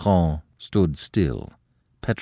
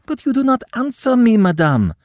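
high, loud, pleading outburst; a niece begging for an answer; despairing cry; 6 dB louder than usual. A narrator's reading. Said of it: "cried Aline in despair".

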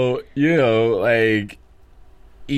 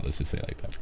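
whisper; 6 semitones lower, 9 dB quieter, faster than usual. Real, not acted. Soft euphemism aside.

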